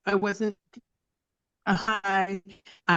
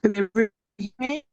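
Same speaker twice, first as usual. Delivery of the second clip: bad connection; 6 semitones higher, faster than usual. Real, not acted. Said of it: "you're breaking up real bad".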